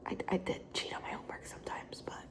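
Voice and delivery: a hushed, guilty whisper; whispered confession. Real, not acted. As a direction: drop to a whisper as if confessing a secret. Confessing cheating, whispered aside.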